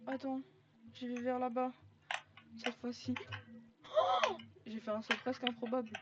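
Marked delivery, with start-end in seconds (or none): 3.8-4.4 high startled cry; sudden shocked exclamation; surprised yelp. Real, not acted. Lands an improbable jump.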